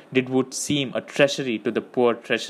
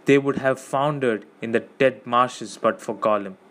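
ordinary delivery

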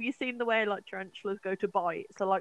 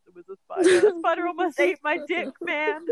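high, loud, panicky and close to tears; panicked; 9 semitones higher, 7 dB louder than usual. Retold or imitated. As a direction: blurt it out freaked and shaken. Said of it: "And he's like".

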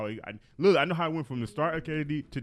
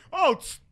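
excited; 14 semitones higher, 8 dB louder than usual. Retold or imitated. Imitating jumpers.

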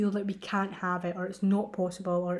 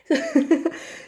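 loud, high laugh; 8 semitones higher, 7 dB louder than usual. Real, not acted. Podcaster after calling for a drum roll, laughing loudly. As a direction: laugh out with excitement.

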